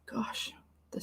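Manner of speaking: a breathy whispered groan. Real, not acted. Streamer whispering about the strain on her brain. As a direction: whisper it like the effort drained you.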